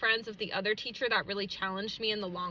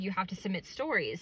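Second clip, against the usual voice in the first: ordinary talk, nothing marked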